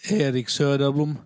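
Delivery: usual speaking voice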